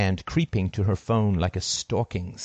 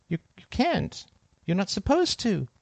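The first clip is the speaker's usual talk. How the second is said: higher, softer, stumbling; nervous stammer; 10 semitones higher, 3 dB quieter than usual. Acted. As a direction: stammer out of fear. Scared girl stammering.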